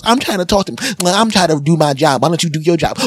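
high, indignant retort; angry cashier. Retold or imitated. Lady A arguing with Lady B, high and indignant. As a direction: snap back like a fed-up cashier. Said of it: "and Lady A goes".

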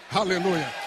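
ordinary talk

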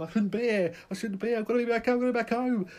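high, loud, fast panicked cry; frantic panic. Acted. Rioters in shops panicking to get home, frantic. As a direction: scramble to get back home.

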